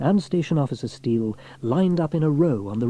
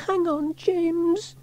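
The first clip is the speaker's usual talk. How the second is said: high, drawn-out call; slow, very high child's shout without extra force; girl calling out; much slower than usual. A narrator's reading. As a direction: call to someone far away in a young girl's voice. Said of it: "she called".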